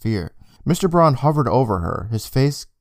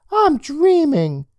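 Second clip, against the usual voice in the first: put-on whiny kid voice; 4 dB louder, much slower than usual. Boy hiding under covers.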